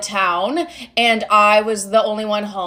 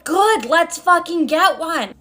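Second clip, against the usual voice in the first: higher, slow, mock-angry mom; mom snapping back; 5 semitones higher, slower than usual. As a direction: drag out each word like a fed-up mom. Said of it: "And my mom was like".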